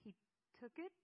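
halting, incredulous question; disbelief. A narrator's reading. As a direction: ask it as if you can't believe it. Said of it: "I said in disbelief".

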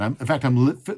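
ordinary talk, nothing marked

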